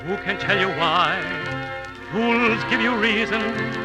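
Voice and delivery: usual speaking voice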